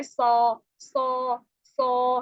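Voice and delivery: सौ said with molars open, full and heavy; open-jaw saad. Shown as a demonstration. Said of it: "दाढ़ों को आपने खोल के रखना है". (दाढ़ें खोलकर भारी सौ)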